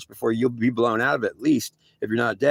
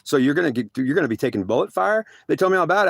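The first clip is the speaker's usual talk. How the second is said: ordinary delivery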